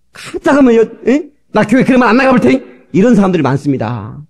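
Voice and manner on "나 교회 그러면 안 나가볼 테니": mock threat. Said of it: "부인한테 협박한다니까?".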